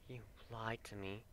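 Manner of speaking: soft, hoarse murmur; murmured hoarsely. A narrator's reading. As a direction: mutter it into her neck. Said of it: "he murmured hoarsely".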